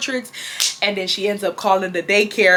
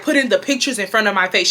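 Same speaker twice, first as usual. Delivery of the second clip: usual speaking voice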